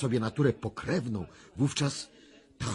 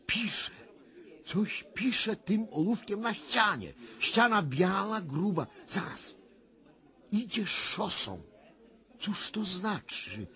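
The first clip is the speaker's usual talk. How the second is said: higher, rising alarm as the vision unfolds; higher, tense visionary urgency; agitated trance vision; 10 semitones higher than usual. Acted. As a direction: speak as if seeing it, growing alarmed.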